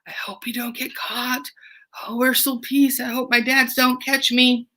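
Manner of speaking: hushed, worried "I hope we don't get caught"; teacher as Marie worrying that her dads will catch her, hushed; nervous Marie. Acted. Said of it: "she also might be a little nervous".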